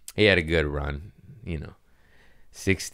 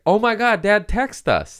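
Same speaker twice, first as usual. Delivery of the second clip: high, loud, excited outburst; excited disbelief; 4 dB louder than usual. Retold or imitated. Siblings amazed that their father texted, excited. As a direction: exclaim it as startling news.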